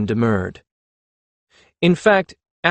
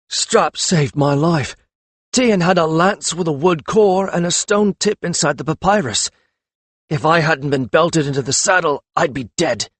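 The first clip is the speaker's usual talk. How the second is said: injured jouster talking through swollen lips, a little higher and slowed; slurred, muffled speech; 6 semitones higher, 3 dB louder than usual. A narrator's reading. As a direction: mumble through a swollen jaw. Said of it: "words were slurred and muffled".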